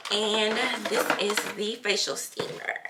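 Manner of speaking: ordinary delivery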